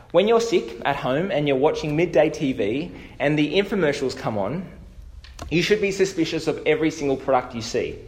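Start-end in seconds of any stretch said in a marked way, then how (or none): none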